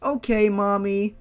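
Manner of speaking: put-on chirpy small boy answering his mommy; little boy voice. Reader voicing the little boy Karma to mommy, chirpy kid voice.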